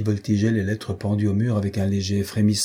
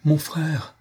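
s'écrier, plus aigu, en élan vers son frère; cri de joie; 6 semitones higher than usual. A narrator's reading. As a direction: appeler son frère avec ferveur, voix qui monte. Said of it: "s'écria-t-il". (un frère s'écrie en retrouvant Saint-Georges, aigu et fervent)

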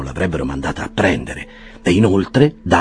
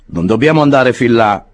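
Jackson the clerk; 5 semitones higher, 6 dB louder than usual. A narrator's reading. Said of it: "rispose Jackson".

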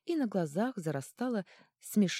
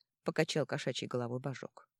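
usual speaking voice

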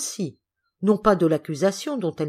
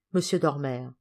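usual speaking voice

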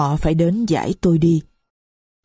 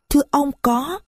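put-on higher, small voice of a young girl; little girl's voice; 8 semitones higher than usual. Little Marie complains to the stranger, high child voice. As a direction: speak as a little child who is hurt.

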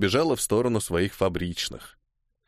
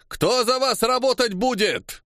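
high, slow angry shout; barked challenge; 11 semitones higher than usual. A narrator's reading. Cossack commander scolding strikers, barking. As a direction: berate the strikers from horseback. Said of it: "гаркнул командир казаков".

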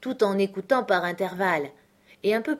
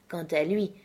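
plain reading voice

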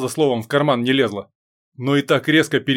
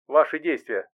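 soft computer voice; 4 dB quieter than usual. A narrator's reading. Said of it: "мягкий голос бортового компьютера".

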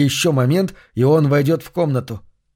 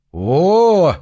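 higher, louder delighted "oh"; pleased exclamation; 5 semitones higher, 4 dB louder than usual. Acted. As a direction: greet the smell of dinner with delight. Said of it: "довольным голосом воскликнул он".

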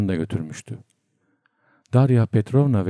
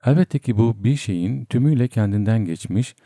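usual speaking voice

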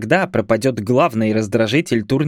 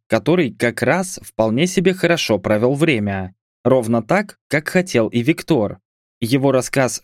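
ordinary delivery

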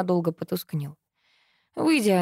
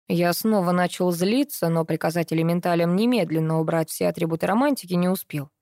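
ordinary delivery, nothing marked

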